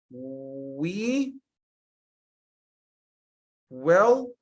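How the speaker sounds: slow, word-by-word example; spaced-out plain sentence; slow dictation. Shown as a demonstration. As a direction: read each word apart. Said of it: "This is an ordinary sentence".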